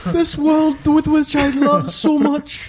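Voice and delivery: high, very slow, grand tragic declaiming; milk it like a dying Hamlet; hammy stage actor. Pretend. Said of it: "It's a bunch of Hamlets".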